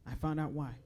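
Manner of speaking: ordinary talk, nothing marked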